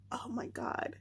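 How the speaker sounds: slow, breathless whisper of "oh my God"; a reader reliving a tense scene, whispering. Real, not acted. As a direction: hush it like the scene is still happening. Tense moment of the plot.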